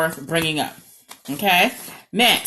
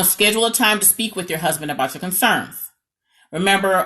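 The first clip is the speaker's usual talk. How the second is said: ordinary talk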